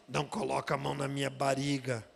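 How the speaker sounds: low, monotone mock demon. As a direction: imitate a demon with a southern tapped R in "bariga". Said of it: "o demônio fala assim". (voz grave de demônio imitado)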